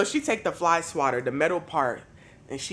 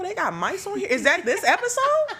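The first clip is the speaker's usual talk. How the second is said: much higher, puzzled half-laughing questions; baffled; 13 semitones higher than usual. Retold or imitated. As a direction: wonder if the mice are on the show. Confused hearing squeals on TV.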